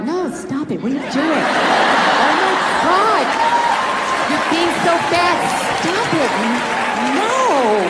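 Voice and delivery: drawn-out mock-seductive protest; hammed-up playful pleasure, words stretched out; coy, unconvincing no. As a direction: say no while clearly meaning yes. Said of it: "that's not a fucking no".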